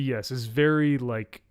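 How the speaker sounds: ordinary talk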